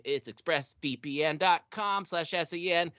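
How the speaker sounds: usual speaking voice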